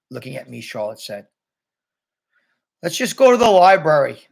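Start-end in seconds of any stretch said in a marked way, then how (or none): 2.8-4.3 louder, higher, mildly fed-up call; bored call-out. A narrator's reading. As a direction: call out as if tired of all this. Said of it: "Jack called out, sounding really bored".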